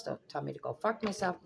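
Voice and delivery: ordinary talk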